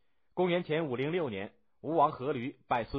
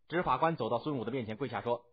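plain reading voice

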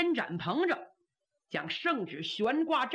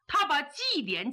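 ordinary delivery, nothing marked

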